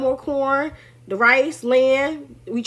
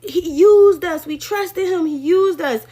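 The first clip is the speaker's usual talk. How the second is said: retold tearful plea; 5 semitones higher, 4 dB louder, slower than usual. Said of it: "she was crying and she was saying".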